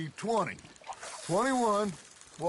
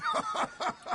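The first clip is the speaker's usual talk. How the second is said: high, cackling belly laugh; hearty laugh. Acted. Friend ending his tale about Arthur's bass, laughing hard. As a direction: laugh hard at your own punchline.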